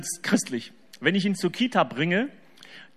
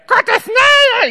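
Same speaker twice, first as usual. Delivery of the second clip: put-on high, loud, very slow child's shout; drawn-out high child yell; crowed child shout; 12 dB louder than usual. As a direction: bellow it across the Kita like a little boy. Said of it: "kräht der so".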